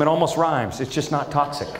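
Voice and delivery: ordinary talk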